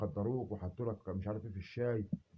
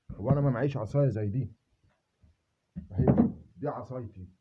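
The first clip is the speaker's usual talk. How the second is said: loud and defiant, daring them; louder challenge; 6 dB louder than usual. Real, not acted. Showing the stick to his enemies, louder and defiant. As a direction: raise the voice while holding up the stick.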